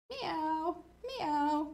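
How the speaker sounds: high, drawn-out meow; cat meow. A narrator's reading. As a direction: cry like a storybook cat. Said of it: "cried the cat".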